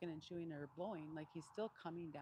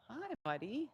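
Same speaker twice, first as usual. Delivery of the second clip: gentle greeting; 6 semitones higher, 4 dB louder, slower than usual. Real, not acted. Greeting the donkey.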